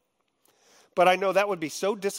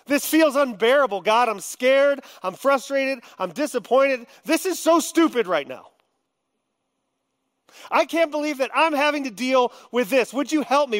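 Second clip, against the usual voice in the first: higher, raw frustrated outburst; venting to God; 7 semitones higher, 3 dB louder than usual. Shown as a demonstration. Believer venting his anger and confusion to God: heated cry. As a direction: unload it all on God, pitch climbing. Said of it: "it's okay to vent to God".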